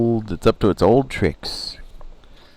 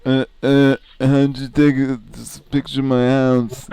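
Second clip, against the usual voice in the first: higher, louder stammering dope; dimwit voice; 7 semitones higher, 3 dB louder than usual. Pretend. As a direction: stammer like a real dummy. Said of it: "I was just doing a dimwit voice".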